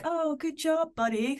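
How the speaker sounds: a high, breezy pat on the back; lightly mocking the clueless fan; mock shallow praise.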